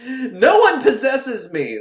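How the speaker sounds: loud, high, slow protest breaking into laughter; comic spooky denial; mock possessed. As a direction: wail it like a hammy possessed woman.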